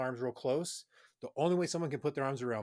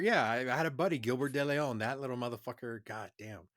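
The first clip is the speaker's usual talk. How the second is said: ordinary talk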